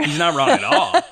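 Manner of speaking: high laughing agreement; words squeezed out through laughter. Real, not acted.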